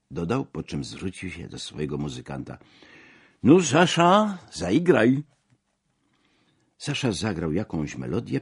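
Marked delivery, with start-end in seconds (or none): 3.4-5.2 loud, high call to a musician; high-pitched, boisterous command. A narrator's reading. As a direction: holler the order across the room. Calls out to his musician.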